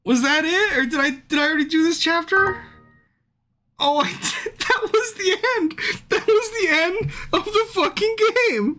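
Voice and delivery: high, stunned and incredulous; shocked disbelief. Real, not acted. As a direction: voice jumps up in disbelief. Stunned the game just ended.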